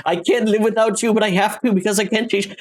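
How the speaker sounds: high, strained lover's farewell; mock anguished Picard. Picard leaving the woman of his dreams, mock anguished.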